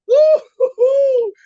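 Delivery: high, drawn-out woo-hoo cheer; slow high-pitched celebratory cheer; excited whoop. Real, not acted. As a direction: whoop it out like a fan. Host cheers about the show.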